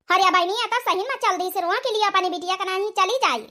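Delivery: usual speaking voice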